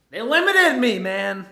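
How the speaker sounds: loud, high exasperated shout; frustrated outburst. Real, not acted. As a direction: blurt it out, fed up. Venting about betting limits.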